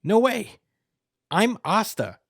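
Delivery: high, loud, outraged denial; indignant disbelief. Retold or imitated. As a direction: shout it like a hotheaded hero. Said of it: "Asta's like".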